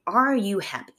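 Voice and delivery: ordinary talk, nothing marked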